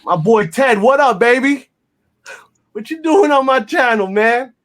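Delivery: happy shout-out to his friend Ted; excited shout-out. Real, not acted. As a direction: call out to your buddy with energy. Calling out to a friend watching.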